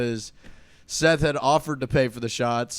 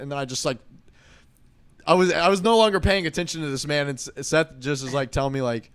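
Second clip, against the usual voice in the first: ordinary talk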